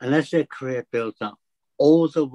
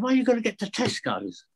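puzzled teacher; 9 semitones higher than usual. Retold or imitated. Teacher asks, voiced higher.

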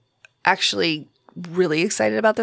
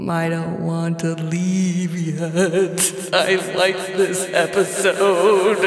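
mock sad; much slower than usual. Doesn't want show to end.

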